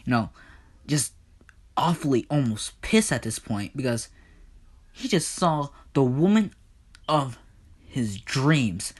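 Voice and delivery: ordinary delivery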